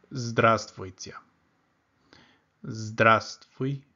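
Здравствуйте said slowly, every sound drawn out; slow teaching model. Shown as a demonstration. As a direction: spell it out slowly for the learner. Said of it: "So here we have hello".

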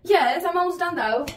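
high, put-on British accent; playful fake British lilt. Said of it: "I started speaking back in a British accent".

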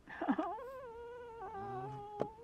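squeaky laughing fit; high laugh. Real, not acted.

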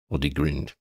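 ordinary delivery

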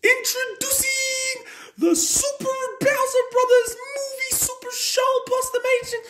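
sustained, monotone-pitched title announcement; show announcer. Acted. Director presents his movie to Dad, grand announcer. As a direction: keep announcing without letting up.

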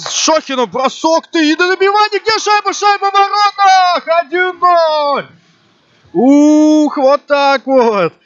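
excited hockey goal call. Real, not acted. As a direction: shout the goal, pitch soaring. Commentator calls a hockey goal.

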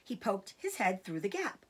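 plain reading voice

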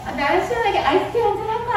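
high, quick coy invitation; coaxing temptress. Acted. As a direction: play the wife coaxing a servant. Wife tries to seduce Joseph. (유혹하듯 높고 빠르게)

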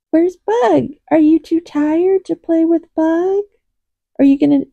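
high-pitched pet-talk to a cat; kitty voice. Real, not acted. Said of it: "Sorry for my kitty voice".

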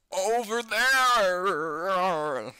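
loud, high, drawn-out creepy wail; mimicked ghost-girl screech, very slow; imitating her shriek. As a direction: stretch the words into a ghostly howl. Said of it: "That's how she sounds like".

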